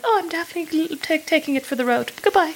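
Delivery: high, embarrassed squeak; flustered blurt. Retold or imitated. To the cafe staff, a high mortified squeak. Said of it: "I was so scarlet that I was like".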